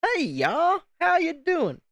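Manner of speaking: very high, swooping mock Southern drawl; butchered Southern accent. Shown as a demonstration. Unapologetically showing off to chat, butchered Southern accent. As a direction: ham up a high-pitched Southern greeting. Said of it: "I'm butchering the accent right now".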